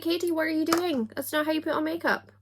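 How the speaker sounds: high-pitched mock-aghast scolding, quick at the end. Aghast viewer scolding Katie over her makeup, mock horror. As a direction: play an appalled makeup expert. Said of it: "are probably sitting aghast".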